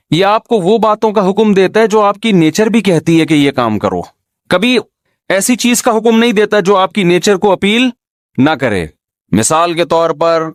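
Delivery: ordinary talk, nothing marked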